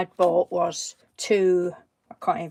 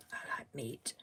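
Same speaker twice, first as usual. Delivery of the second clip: whispering; 8 semitones lower, 17 dB quieter than usual. Real, not acted. Whispered aside.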